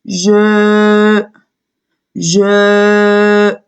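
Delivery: "je" held long on a level pitch; drawn-out syllable. Shown as a demonstration. Teacher sounding out a syllable for pupils, "je" held long. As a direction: stretch the vowel of "je" steadily. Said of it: "ça nous donne".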